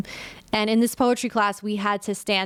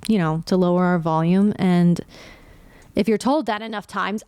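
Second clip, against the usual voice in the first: normal talking voice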